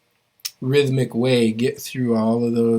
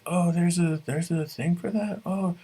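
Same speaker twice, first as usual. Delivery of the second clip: high, mock-surprised discovery voice; imitated surprise; 8 semitones higher than usual. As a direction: play a learner suddenly finding a word.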